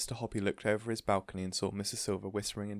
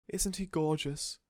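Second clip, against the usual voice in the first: high, slow, adoring; beaming doting lady; 8 semitones higher, 4 dB louder, slower than usual. A narrator's reading. Missus Silver beaming up at Mister Hoppy, adoring. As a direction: gush over the tortoise.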